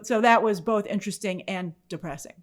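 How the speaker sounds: ordinary talk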